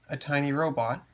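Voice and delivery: usual speaking voice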